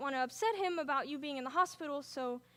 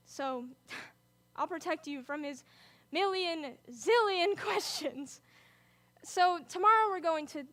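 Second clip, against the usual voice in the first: ordinary talk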